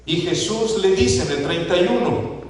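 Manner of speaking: usual speaking voice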